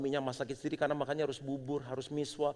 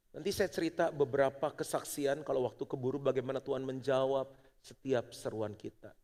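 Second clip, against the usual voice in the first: normal talking voice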